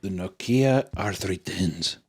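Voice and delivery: slow, put-on Cuban accent; mock Cuban accent. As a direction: draw out the name in a fake Cuban accent. Mimics Cuban saying the phone name.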